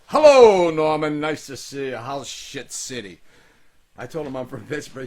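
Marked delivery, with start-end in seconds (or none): 0.1-3.2 put-on grand theatrical old actor; booming stage actor greeting, then slow; Shakespearean actor voice. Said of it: "he had a voice like a Shakespearean actor".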